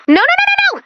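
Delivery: high, rising panicked nos; desperate string of nos; distraught cry. Acted. Panic over lawbot.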